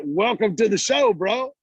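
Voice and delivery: pumped-up welcome to the show; hyped welcome. Retold or imitated. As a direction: greet him like a hype man. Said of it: "like that".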